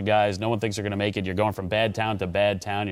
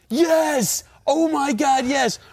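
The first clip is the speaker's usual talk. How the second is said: high-pitched, drawn-out winner's yell; retold jubilant shout; much slower than usual. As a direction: act out a man who just won the lottery. Retold lottery winner's jubilant outburst.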